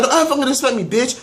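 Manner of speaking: high mocking voice, sister's fury; mock sister yelling. Imitating the sister before she throws the purse, high. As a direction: pitch it high to mock her anger. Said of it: "So she was like".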